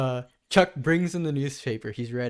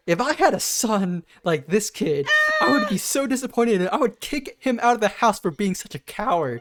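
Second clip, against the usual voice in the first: mom scolding; 7 semitones higher, 5 dB louder than usual. Retold or imitated. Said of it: "And the mom's like".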